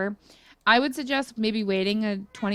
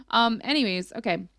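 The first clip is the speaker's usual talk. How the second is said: usual speaking voice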